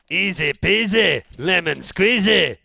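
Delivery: loud, slow Scottish game voice line; Scottish accent. Retold or imitated. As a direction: belt it out like a Scottish soldier. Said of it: "I've never heard a Scotsman say that".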